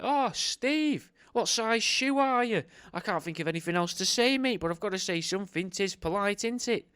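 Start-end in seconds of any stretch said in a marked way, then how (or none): 0.0-2.6 high, slow, strained politeness; high awkward small-talk voice. Retold or imitated. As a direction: squeak out a desperate question. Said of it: "And you're like".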